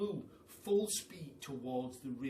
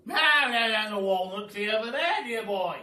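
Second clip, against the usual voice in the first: loud, grand aristocratic Duke, higher; booming Duke; 7 semitones higher, 13 dB louder than usual. Acted. The Duke welcomes the hungry giraffe, booming and grand. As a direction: boom it out like a jolly grand duke.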